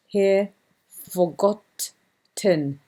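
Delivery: forgotten, slow, held on the double T; doubled T stressed. Shown as a demonstration. Teacher showing the double T in forgotten, slow and stressed. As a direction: lean into the double letter in forgotten. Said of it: "two letters to add the emphasis".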